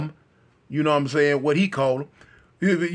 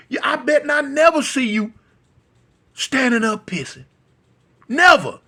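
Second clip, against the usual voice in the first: angry scolding; 7 semitones higher, 4 dB louder than usual. Retold or imitated. Rick berating the boy.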